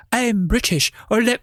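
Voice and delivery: high, exaggerated British accent; mocking, badly faked British voice. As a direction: do a deliberately terrible British accent. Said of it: "that's why I'm doing a bad one".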